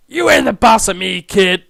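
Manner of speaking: loud, defiant retort; defiant student. Acted. Student talking back to the teacher, defiant.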